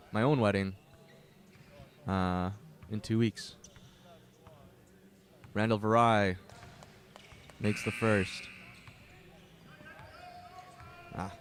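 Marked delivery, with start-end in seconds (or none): 5.5-11.3 louder calling of each play, with chatty asides; play-by-play announcer calling the first half; live play-by-play. Acted. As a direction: keep the game call going. Live basketball play-by-play call.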